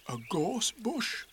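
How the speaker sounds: soft Pooh bear voice. A narrator's reading. Pooh whispering.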